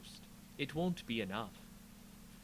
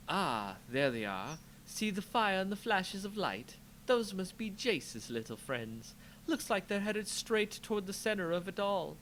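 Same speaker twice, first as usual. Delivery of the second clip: amused sorceress; 7 semitones higher than usual. Acted. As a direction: relish it like watching a show. Liliana voiced.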